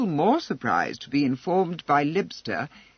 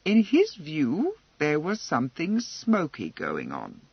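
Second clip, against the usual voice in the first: plain reading voice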